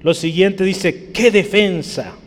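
full-force preaching. Acted. Preacher calling out each result to the congregation, preaching hard. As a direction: thunder out every question to the pews.

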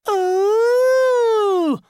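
long, high, loud mocking ooh. A narrator's reading. Housemate mocks him for refusing, long teasing ooh.

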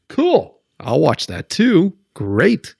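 high, mock-delighted "Cool"; sarcastic cool. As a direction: fake enthusiasm, dripping sarcasm. Mocking the feed's fake variety.

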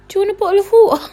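high, loud, squeaky question; exaggerated over-eager offer; awkward blurted offer. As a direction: blurt it out in a panicked-polite squeak. Re-enacts her awkward blurted offer.

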